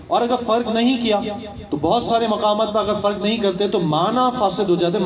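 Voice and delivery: normal talking voice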